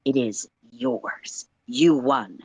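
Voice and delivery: ordinary delivery, nothing marked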